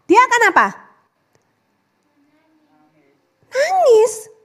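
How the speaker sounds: lightly acted whiny crying child; a touch of a child starting to cry; mock crying.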